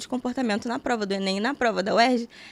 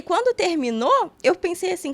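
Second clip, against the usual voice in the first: normal talking voice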